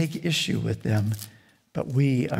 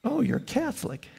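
teasing; 10 semitones higher, 4 dB quieter than usual. Retold or imitated. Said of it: "he liked to say".

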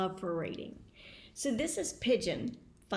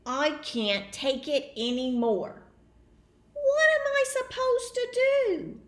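high, loud wail of despair; desperate pigeon; 4 semitones higher, 6 dB louder than usual. Acted. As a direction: throw up your wings.

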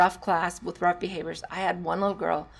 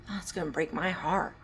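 usual speaking voice

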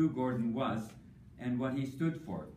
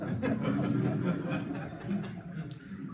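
laughing; 5 semitones higher than usual. Real, not acted. Laughing at frustration remark.

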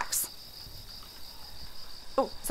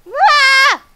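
1 startled yelp; 10 dB louder than usual. Real, not acted. Startled by game.

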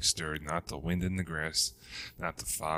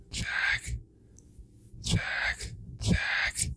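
ghostly hiss; much slower than usual. A narrator's reading. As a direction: hiss the name from far off across the swamp. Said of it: "the voice hissed".

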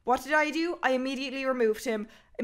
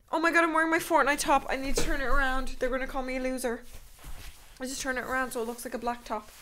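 panicky rush; faster than usual. Real, not acted. Panicking about wearing wrong top.

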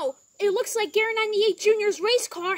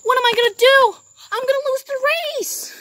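louder, higher panicked wail; panicked cry; 5 semitones higher, 8 dB louder, faster than usual. Pretend. Crashed driver panics aloud about losing, loud and frantic.

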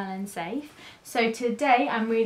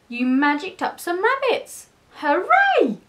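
high excited praise rising to a hooray; delighted cheering; 8 semitones higher, 5 dB louder than usual. A narrator's reading. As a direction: celebrate his trick. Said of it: "the other cats cheered".

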